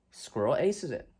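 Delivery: usual speaking voice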